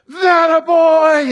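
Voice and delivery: very high, loud and slow shriek; proud dad shrieking. Retold or imitated. Father in the bleachers shrieking at his son's basket. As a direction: stretch it out like a thrilled father. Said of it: "my dad just going".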